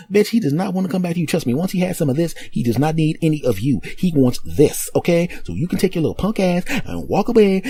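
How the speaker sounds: high put-on woman's voice, taunting; sassy dismissal. New girlfriend sending the ex away, sassy. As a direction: wave her off with sass.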